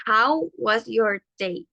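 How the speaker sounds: slow, careful English model; slow model phrase. Shown as a demonstration. Teacher presenting a new phrase slowly. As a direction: lay out each word slowly. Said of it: "La siguiente es".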